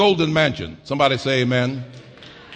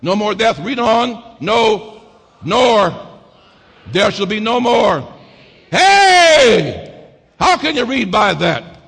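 louder and higher, shouted sermon climax; preaching at full force; 6 semitones higher, 5 dB louder, slower than usual. Acted. Preacher crying out the promises to the church, full-force whoop. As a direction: build the list into a shout.

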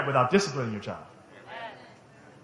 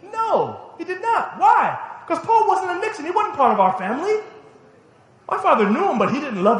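much higher, rising intensity; emphatic preaching; 11 semitones higher, 3 dB louder than usual. Real, not acted. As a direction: hammer the point to the congregation. Preacher drives the point home.

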